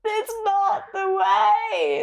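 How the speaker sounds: high, slow, breaking cry on "way"; real tearful cry. Real, not acted. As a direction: let the voice crack with tears. Emotional breaking point.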